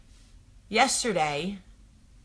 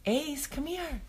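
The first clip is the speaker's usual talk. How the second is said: much higher sing-song call across the yard; calling the dog; 10 semitones higher than usual. Retold or imitated. Mom calling the dog back from the open gate, high call. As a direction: call him in from the back deck.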